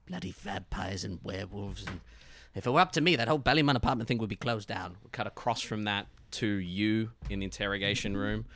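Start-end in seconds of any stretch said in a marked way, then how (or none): 0.1-5.0 irritated grumbling detective. Acted. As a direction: mutter in disgust.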